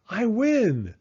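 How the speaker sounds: high, loud cry of triumph; old woman crowing over the nuts; gleeful win. Acted. Baba Brena wins the game.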